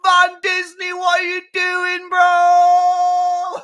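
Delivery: high, loud delighted yelling; gleeful shout. Real, not acted. Fan mocking a rival defender's error, gleeful shout. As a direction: scream with glee at the blunder.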